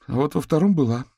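put-on high, anxious young woman; Katerina's voice. A narrator's reading. Katerina tells the detectives there was a card, anxious. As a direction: blurt it out anxiously. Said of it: "уточнил Виктор".

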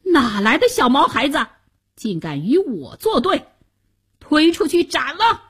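indignant, rising to a high command; enraged. A narrator's reading. Chancellor condemns the boy who defied him, enraged. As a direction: rage at an upstart, then order him out. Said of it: "白脸宰相闻言大怒".

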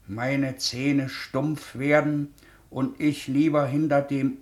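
langsam, etwas lauter vorgelesen; Märchen vorlesen. Acted. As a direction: lies dem kranken Kind ein Märchen vor. Liest dem Kind Märchen vor. (Onkel liest dem Mädchen die Stadtmusikanten vor)

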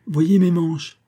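lightly acted magician, high and loud; showman's patter. A narrator's reading. Magician has the ladies inspect his clothes, showman's patter. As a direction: show it off with a rising lift.

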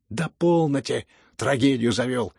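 loud, dismissive cry; irritated shout. A narrator's reading. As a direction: cut him off loudly with impatience. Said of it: "крикнул Ганя".

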